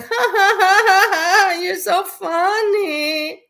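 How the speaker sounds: high, loud fake laughing; sarcastic over-the-top fake laugh; mock laughter. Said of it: "I'm not like".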